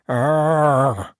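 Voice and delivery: high, loud, sustained growled noise; muffled mouthful growl. A narrator's reading. Earstripe answering with teeth in the boot, muffled growl. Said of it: "with a mouthful of boot".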